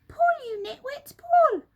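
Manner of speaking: very high, very loud bellow; straining shout. Acted. Mugglewump yelling at helpers to pull the carpet, bellow. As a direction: haul and yell.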